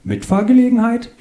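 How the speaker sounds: high, sing-out question from the car; calling out question. Acted. Woman to strangers at the taxi stand, high calling question. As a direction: call it up high, rising at the end.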